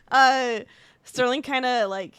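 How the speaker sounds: normal talking voice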